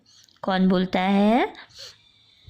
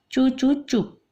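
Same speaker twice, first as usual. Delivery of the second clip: halting scold; 5 semitones higher than usual. Retold or imitated. Father caught mid-scold, a stammering stop. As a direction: start to scold, then choke it back. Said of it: "तो अब्बा ने क्या बोला?".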